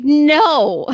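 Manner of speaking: retold sharp refusal. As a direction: cut in with a loud imitated refusal. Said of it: "the second Artie's like".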